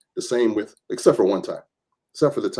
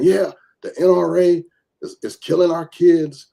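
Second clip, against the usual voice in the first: mock protester accusation; 7 semitones higher, 6 dB louder than usual.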